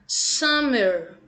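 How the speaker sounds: summer said slowly as a model, falling; Summer, each sound clear then a falling end; teacher modelling Summer for kids to repeat; model word. Shown as a demonstration. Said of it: "Repete com a tia [name]".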